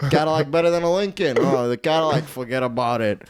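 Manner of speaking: Italian-American wise guy debating cars; Italian mobster. Acted. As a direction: argue it like an Italian mob-movie character. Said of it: "You're turning into one, too".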